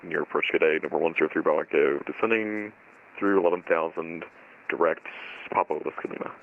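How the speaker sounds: tinny pilot voice over radio; radio transmission. Real, not acted. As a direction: talk like through a headset radio.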